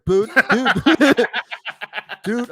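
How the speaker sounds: exasperated dude. Retold or imitated. Umpire laughing at the balking kid, exasperated. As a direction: plead with him through a laugh.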